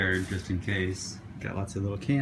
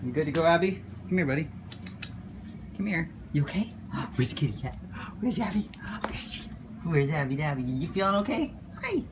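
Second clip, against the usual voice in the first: higher, hushed coaxing to a pet; 6 semitones higher than usual. Real, not acted. Talking to the nervous dog.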